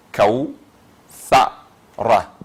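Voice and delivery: kaou, fa, ra as three separate syllables with pauses. Shown as a demonstration. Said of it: "on a trois syllabes".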